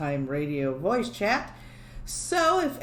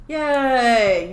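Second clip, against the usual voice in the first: mock cheer; 3 semitones higher, 8 dB louder than usual. Real, not acted. Cheering one viewer.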